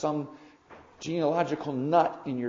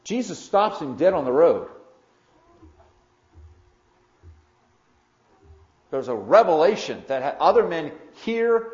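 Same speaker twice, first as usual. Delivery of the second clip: loud, higher-pitched preacher's proclamation; emphatic preaching; 6 semitones higher, 10 dB louder than usual. Real, not acted. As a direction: preach it out forcefully, punching the key words. Preacher drives home the Damascus road story.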